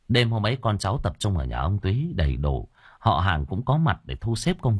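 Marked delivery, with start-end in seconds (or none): none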